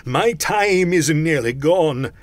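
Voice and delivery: loud, slow, drawn-out wail; urgent cry. A narrator's reading. Ghost warning time is short, crying out. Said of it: "cried the ghost".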